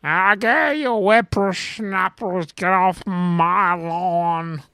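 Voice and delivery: put-on hollering at kids like a cranky grandpa; grumpy old man voice. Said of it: "then I could yell about the kids".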